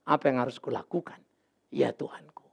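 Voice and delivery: low, soft, fading to a whisper; humble whispered prayer. Shown as a demonstration. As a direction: ask God humbly, under your breath. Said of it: "patut engkau bertanya".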